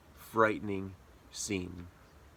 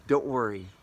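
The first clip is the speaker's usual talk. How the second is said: normal talking voice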